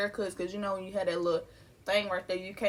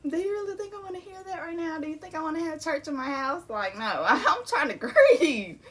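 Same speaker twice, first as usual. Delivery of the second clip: fed-up inner rant; 8 semitones higher than usual. Retold or imitated. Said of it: "I was just like".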